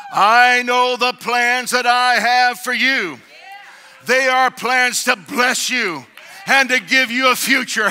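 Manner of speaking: proclaiming scripture. Acted. Preacher proclaims God's promise as scripture.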